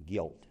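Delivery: "Guilt" low and soft, set apart and falling; stress on guilt. Shown as a demonstration. Pastor lets the word sink in for the congregation, low and weighty. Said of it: "God forgave the guilt".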